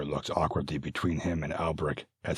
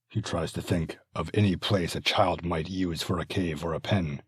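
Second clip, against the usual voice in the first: ordinary delivery, nothing marked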